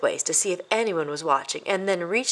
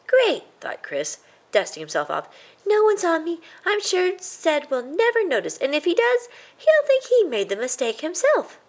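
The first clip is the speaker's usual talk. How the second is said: put-on much higher, pleased boyish voice; a little mouse proud of getting away with it; smug excited young mouse; gloating young mouse; 10 semitones higher than usual. A narrator's reading. Chris gloats.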